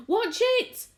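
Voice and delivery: very high, loud warning; sharp cry. A narrator's reading. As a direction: cry out as the room lurches. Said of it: "cried Miss Spider".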